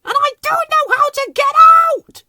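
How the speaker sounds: very high, loud distressed squeal; frantic squeaky creature shout; calling out. A narrator's reading. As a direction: wail it for rescue. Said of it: "called Whimsy".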